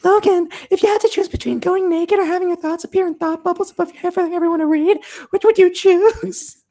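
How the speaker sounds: very high put-on voice, lingering at the end; high-voiced girl. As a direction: pose a teasing game question way up high. Voices Emma asking Logan.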